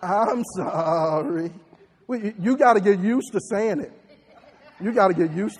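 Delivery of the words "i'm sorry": slow, deliberate repeat of I'm sorry; slow practice apology. Shown as a demonstration. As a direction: lead the crowd, say it slowly. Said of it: "Say it again".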